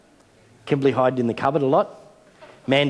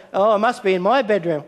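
mock guessing; 7 semitones higher than usual. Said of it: "and they're".

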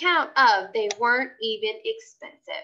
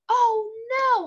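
high, loud cry of alarm; hollered; 10 semitones higher, 4 dB louder than usual. A narrator's reading. Lucille hollering at a spill on the tablecloth. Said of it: "hollered Lucille".

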